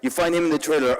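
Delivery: normal talking voice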